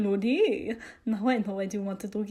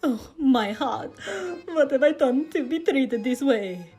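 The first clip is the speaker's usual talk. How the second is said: mock heartbreak; 3 semitones higher, 4 dB louder than usual. A narrator's reading. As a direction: wail it as if heartbroken, hammed-up. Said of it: "an expression of mock hurt".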